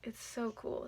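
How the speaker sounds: soft, slow and awed; almost breathless wonder; a hushed aside over the palette; hushed awe. Real, not acted.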